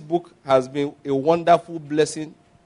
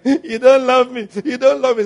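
mock crying wife; 7 semitones higher, 4 dB louder than usual. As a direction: whine it like a sulking wife. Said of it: "As your wife is crying".

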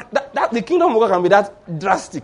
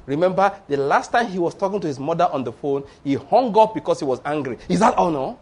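ordinary talk, nothing marked

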